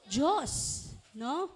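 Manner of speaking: usual speaking voice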